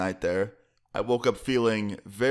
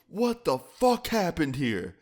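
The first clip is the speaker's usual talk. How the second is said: slow, high-pitched mock yell; imitated angry scream; 8 semitones higher, slower than usual. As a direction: imitate a dad exploding at a mess. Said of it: "I hear his dad scream".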